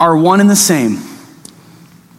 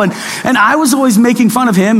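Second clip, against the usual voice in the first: ordinary talk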